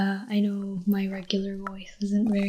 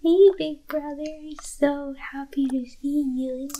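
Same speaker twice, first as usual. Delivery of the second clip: cute little sister voice; 6 semitones higher than usual. Shown as a demonstration. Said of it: "like, little sister".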